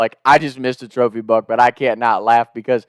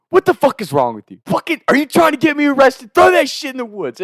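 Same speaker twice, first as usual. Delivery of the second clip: imitating screaming brother; 10 semitones higher than usual. Brother fears getting arrested, mock screaming. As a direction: act out a furious, high-pitched rant. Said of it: "screaming at me and shit".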